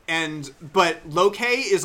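normal talking voice

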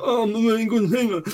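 high, breathy mock-proud contestant. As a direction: ham up a deluded hopeful. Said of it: "somebody would come on and go".

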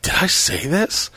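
slow, high anxious question; a touch of panic; mock panicked. Panicked quote.

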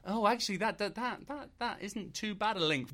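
high, hesitant, mock-impressed viewer; pleasantly surprised. Imitating a viewer warming to a link, pleasantly surprised. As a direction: pitch it up, grudgingly impressed.

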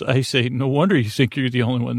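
normal talking voice

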